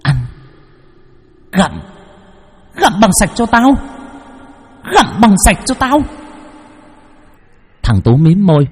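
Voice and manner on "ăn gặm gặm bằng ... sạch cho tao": high, menacing ghost screech; vengeful ghost girl's high, cold command. A narrator's reading. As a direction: screech the order coldly, word by word at first. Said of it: "lạnh lùng mà rít lên".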